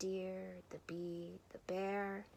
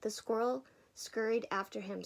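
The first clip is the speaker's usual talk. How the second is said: ordinary delivery, nothing marked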